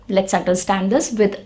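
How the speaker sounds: ordinary talk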